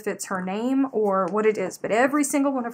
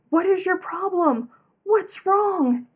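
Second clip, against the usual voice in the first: high, louder mock-alarmed questions; alarmed questioning; 10 semitones higher, 5 dB louder, slower than usual. [name] reacting to the gasping vlogger, alarmed questioning. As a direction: demand to know what is wrong, pitched high.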